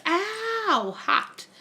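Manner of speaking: sudden yelped ow; ойкнула от горячего клея; real ouch. Real, not acted. Burns herself on hot glue.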